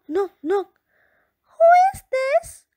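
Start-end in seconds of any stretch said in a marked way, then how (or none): 1.5-2.7 high, loud little rabbit voice; startled high rabbit cry; timid rabbit asking. Acted. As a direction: squeak it out as a nervous bunny. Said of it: "asked Namya".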